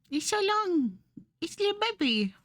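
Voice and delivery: put-on high cutesy baby-talk voice; high squeaky coo over something tiny. Cooing over the drawing.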